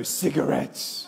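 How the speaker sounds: a slow, unvoiced rasp; deadpan joke. Speaker answers the boy's question with a slow deadpan rasp. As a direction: croak it out, straight-faced. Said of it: "I go".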